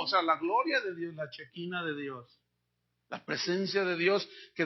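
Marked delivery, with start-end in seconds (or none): none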